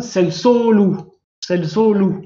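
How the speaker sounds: sel, solou, slowed, stress on the final ou; accent on the ending. Shown as a demonstration. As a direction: drag out sel, solou and hit the end. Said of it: "il y a l'accent, mais sur la fin".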